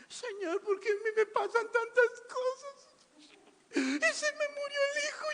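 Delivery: mock weeping widow. Preacher mocking a widow whining to the Lord, mock crying. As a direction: play a self-pitying mourner, sobbing.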